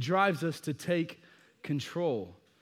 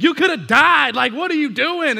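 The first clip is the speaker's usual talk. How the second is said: loud, much higher exasperated yell; lost it; 9 semitones higher, 11 dB louder than usual. Retold or imitated. Dad who lost it after his daughter's near fall, yelling. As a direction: let it all out at her. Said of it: "I had lost it".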